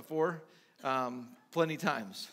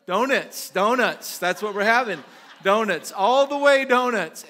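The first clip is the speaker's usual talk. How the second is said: loud, high cheat-day declaration; big, hammed-up indulgence; gleeful giving in; 6 semitones higher, 12 dB louder than usual. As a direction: proclaim each treat like a triumphant food order. Acts out giving in to donuts.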